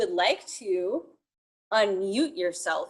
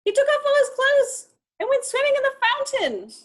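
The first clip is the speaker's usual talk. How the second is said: much higher and louder, shocked delight; scandalised storytelling; 6 dB louder, faster than usual. Acted. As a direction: tell it like the naughtiest secret. Scandalised narration of tiger swimming.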